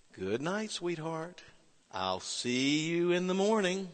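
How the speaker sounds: tender goodnight. Retold or imitated. Father repeating goodnight.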